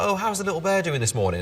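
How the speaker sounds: put-on high, sweet nurse cooing over a baby. Nurse greets the new baby in the morning, high sweet coo. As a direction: coo it like a nurse checking on a newborn. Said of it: "the nurse came in and she was like".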